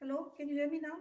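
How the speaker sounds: soft, distant but clearer; barely audible. Real, not acted.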